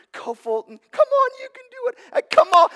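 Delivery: high, pinched, fast cheering voice; squeaky little cheer for a toddler; kid voice. Retold or imitated. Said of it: "my little, my kid voice".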